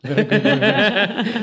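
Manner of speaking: slow, high, laughing echo of the joke. Real, not acted. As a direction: repeat it through a laugh. Echoing a joke while laughing.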